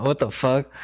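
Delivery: ordinary talk, nothing marked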